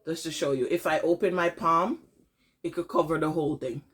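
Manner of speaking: ordinary talk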